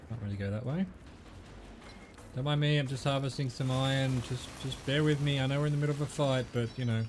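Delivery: ordinary delivery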